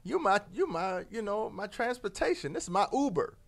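a hint of an easygoing scorpion with a laugh; lightly voiced scorpion, chuckling. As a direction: shrug it off, high and breezy. Scorpion swearing it's harmless.

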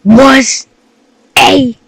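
loud, high and slow; excited. Real, not acted. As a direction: shout the recap still shaken. Recapping scare excitedly.

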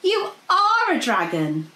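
delighted princess. A narrator's reading. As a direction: marvel at it with a grin. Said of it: "grinned with delight".

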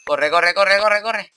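high, very loud urgent yelling; panicked shout. Real, not acted. Player fleeing from danger in the game, shouting urgently. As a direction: yell it in one rising rush.

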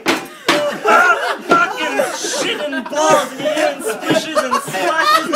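high, loud, drawn-out mock howl; hammed-up swearing in horror; disgusted cursing. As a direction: howl the curse in comic disgust. Bigger brother cursing in disgust.

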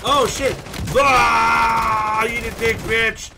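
loud, high combative yelling; startled shout. Real, not acted. Gamer fighting an enemy who appeared, high shouted taunt. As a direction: yell in alarm, then taunt loudly. Sudden firefight in game.

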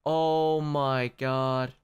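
very slow, low, drained delivery; stunned despair. Real, not acted. Player who lost the climb, stunned despair. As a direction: drag it out, low and defeated. Stunned after losing progress.

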